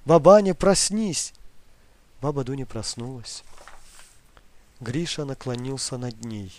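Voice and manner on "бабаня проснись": loud, slow urgent wake-up call. Acted. Grandson urgently waking his grandmother. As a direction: rouse her, loud and insistent.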